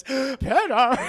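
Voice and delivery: loud, high squealed name; squealing baby talk aunt. Retold or imitated. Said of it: "That's my Aunt May voice".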